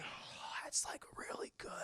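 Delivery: whispered in mock awe; awed whisper. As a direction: whisper it as if secretly tempted. Tempted character's awed aside.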